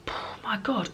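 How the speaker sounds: breathy whispered aside, almost no voice. Real, not acted. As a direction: drop to a whisper in disbelief. Recalling crying at film.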